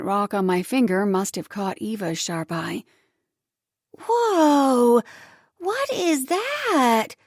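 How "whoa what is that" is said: high, slow, wide-eyed exclaim; amazed little girl. Acted. Girl spotting the diamond ring, high amazed. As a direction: gasp it out like a kid. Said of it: "Eva exclaimed".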